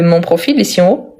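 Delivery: normal talking voice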